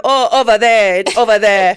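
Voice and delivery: loud, put-on foreign-accented English; broken-English accent. Helping a foreigner with directions, loud broken-English accent. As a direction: mimic their accent, loudly pointing the way. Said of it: "You start breaking broken English".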